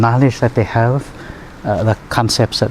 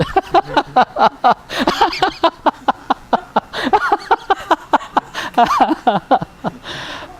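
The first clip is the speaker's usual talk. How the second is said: long wheezing giggle, higher; long high laugh. Real, not acted. Healer cracking up over the patient's reaction, long and high.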